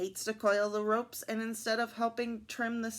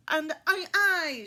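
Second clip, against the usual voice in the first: loud, high pirate cheer; rousing raised aye aye; crew shout; 7 semitones higher, 7 dB louder than usual. A narrator's reading. Crew answering their reformed shipmate, shouting. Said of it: "shout the crew".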